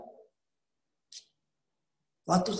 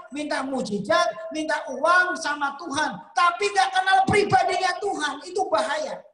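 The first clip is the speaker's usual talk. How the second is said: preaching build; 6 semitones higher than usual. Real, not acted. Preaching at full force.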